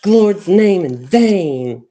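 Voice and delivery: loud, high, slow reprimand; scolding nurse. Retold or imitated. Imitating a nurse telling off a patient, scolding. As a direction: hammer each word in an indignant mock scolding. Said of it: "and she's like".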